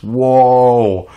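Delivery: loud drawn-out whoa; surprised whoa. Real, not acted. Reacting to the video with a loud amazed whoa. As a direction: let it out as stunned amazement.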